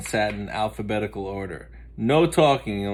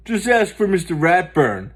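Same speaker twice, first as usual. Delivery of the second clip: Buster's voice; 7 semitones higher, 7 dB louder than usual. Acted. Buster jokes back.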